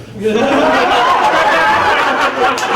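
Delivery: loud high burst of laughter; big laugh. Real, not acted. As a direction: laugh out loud at your own joke. Laughing at own joke.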